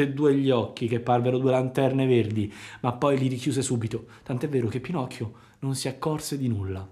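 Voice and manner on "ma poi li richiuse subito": usual speaking voice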